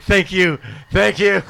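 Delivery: high, loud eager thanks; grateful kid. Retold or imitated. Released by the cop, high loud grateful thanks. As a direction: gush with relief. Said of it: "I was like".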